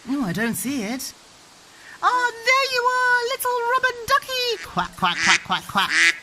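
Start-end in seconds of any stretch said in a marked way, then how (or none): 2.0-4.6 very high sing-song squeal of discovery; playful delight. Pretend. Mum finding the little rubber ducky, delighted. Finding the lost toy duck. 4.6-6.1 low, punchy run of duck quacks. Pretend. Mum showing her child the duck's sound, quacking. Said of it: "What sound does the duck make".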